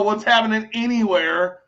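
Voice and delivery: ordinary delivery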